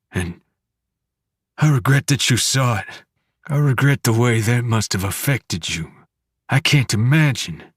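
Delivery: a father breaking down in remorse; tearful regret. A narrator's reading. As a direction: push through the tears.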